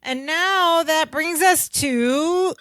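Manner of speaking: a hammed-up slow segment intro; mock announcer. Podcast host introducing lesson time in a slow mock-announcer voice.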